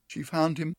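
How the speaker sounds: plain reading voice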